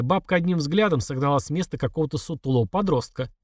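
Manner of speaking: plain reading voice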